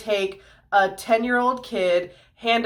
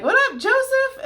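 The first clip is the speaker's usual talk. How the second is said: high, breezy greeting; casual angel. Retold or imitated. Angel dropping into Joseph's dream, casual high greeting. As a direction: greet him like an old buddy. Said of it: "an angel showed up in his dream and was like".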